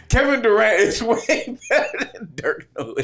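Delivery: high-pitched, laughing insistence. Real, not acted. Insisting to co-host on Durant over Nowitzki, laughing. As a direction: argue it while cracking up. Repeats his claim while cracking up.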